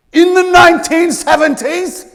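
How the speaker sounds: high, loud, slowed disbelief; incredulous. Real, not acted. Mock disbelief at old technique.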